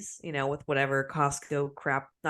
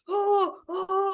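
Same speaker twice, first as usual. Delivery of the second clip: very high drawn-out wails; mock dying cries; 13 semitones higher than usual. Wife mimics husband after the spray, high mock-agony cries. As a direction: imitate someone wailing in agony. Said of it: "I'm, like, dying".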